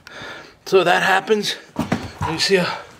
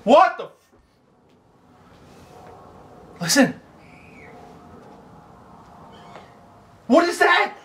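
high, alarmed cry; startled; 14 semitones higher than usual. Real, not acted. Startled by a scream outside, alarmed. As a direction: jump at a sudden noise. Hears a strange noise outside.

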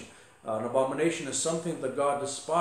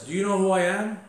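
higher, louder self-important demand; haughty, raised-voice challenge; proud indignation; 5 semitones higher, 6 dB louder than usual. Retold or imitated. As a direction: act out a proud man throwing his weight around. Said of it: "he's proud".

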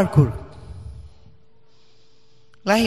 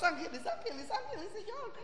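much softer, higher breathless pleading with a nervous laugh; frightened prayer; 12 semitones higher, 20 dB quieter than usual. Retold or imitated. Mother eyes closed during her son's flips, hushed scared praying. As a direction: pray under your breath, eyes shut, terrified.

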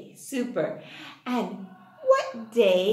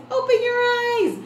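excited reveal; 11 semitones higher, 5 dB louder than usual. Acted. Surprise reveal to the class.

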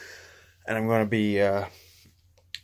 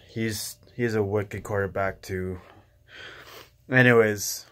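ordinary talk, nothing marked

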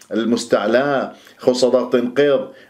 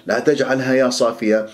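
ordinary talk, nothing marked

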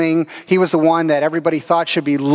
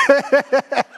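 a laugh breaking into the word that; giggling through it; 7 semitones higher than usual. Real, not acted. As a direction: caught in a real laugh. Laughing at his own cow slip.

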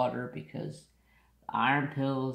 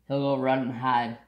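ordinary talk, nothing marked

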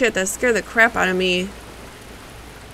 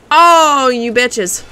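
indignant yell; 2 semitones higher, 7 dB louder, much slower than usual. Real, not acted. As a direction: holler at the enemies who hit you. Hit by enemies in game.

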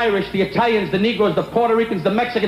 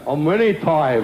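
mock Japanese guy; 6 semitones lower, slower than usual. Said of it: "The Japanese guy went".

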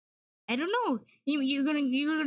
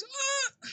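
pained scream; 13 semitones higher than usual. Acted. Flashlight shone in his eyes.

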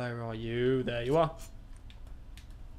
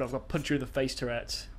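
ordinary talk

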